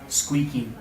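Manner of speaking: normal talking voice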